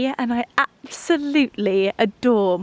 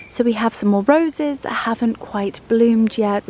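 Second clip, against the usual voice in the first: normal talking voice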